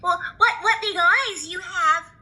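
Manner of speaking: put-on little girl puzzled; high child voice; wondering girl. Girl questions grandma.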